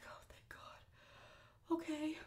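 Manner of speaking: breathy whispered relief, slow; relieved whisper. Retold or imitated. Whispering relief to herself after the interview. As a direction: sigh it out under your breath, very slow.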